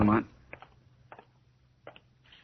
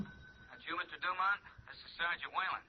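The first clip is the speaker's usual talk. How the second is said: tinny telephone voice; a police sergeant calling on the line; gruff sergeant through a phone receiver; over the phone; 8 dB quieter than usual. Acted.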